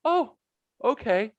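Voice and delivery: very high, halting "oh, okay"; retold awkward surprise. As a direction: react taken aback, voice pitched right up. Taken aback by dab pen.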